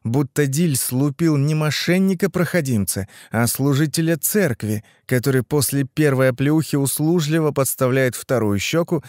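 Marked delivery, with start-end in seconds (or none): none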